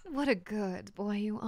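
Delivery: soft, doting pet-talk; cooing praise. Real, not acted. Streamer praising Friday, soft doting coo. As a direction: coo it tenderly and quietly.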